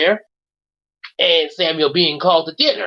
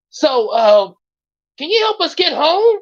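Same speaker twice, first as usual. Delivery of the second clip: pleading character; 10 semitones higher, 4 dB louder than usual. Acted. Character asks for help.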